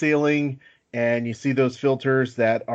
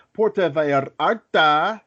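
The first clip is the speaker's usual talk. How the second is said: hammed-up Spanish accent rolling "Puerto Vallarta"; playfully butcher it in a mock Spanish accent; bad Spanish accent; 3 semitones higher, 3 dB louder, slower than usual. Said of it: "That was my Spanish accent".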